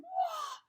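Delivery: very high-pitched "wah"; piercingly high little wail; yelled baby wail. A narrator's reading. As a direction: squeal out a baby's cry. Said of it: "yelled Finn".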